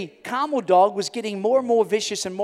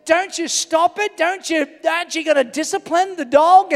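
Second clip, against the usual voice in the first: mildly acted, high-pitched protest; indignant challenge; 8 semitones higher, 5 dB louder than usual. Passerby challenging the owner to stop the dog, raised indignant questioning.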